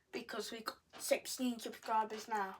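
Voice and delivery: soft whispered aside; whispering. Real, not acted. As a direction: drop to a whisper.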